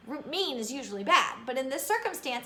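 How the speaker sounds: plain reading voice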